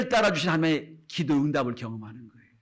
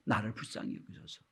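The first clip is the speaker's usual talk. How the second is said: whispered, much softer plea; desperate plea; 6 semitones lower, 10 dB quieter than usual. Retold or imitated. Bartimaeus begs Jesus for mercy, whispered. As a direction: breathe the prayer out as a hushed cry for mercy. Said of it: "두 번 소리 지릅니다".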